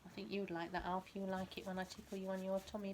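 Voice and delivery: usual speaking voice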